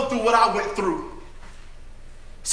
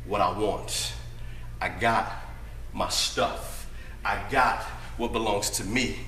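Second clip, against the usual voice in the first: much lower, laid-back swagger voice; cocky grown son; 12 dB quieter than usual. Acted. Son coolly telling his daddy he's leaving, low swagger. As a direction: play it cool and cocky.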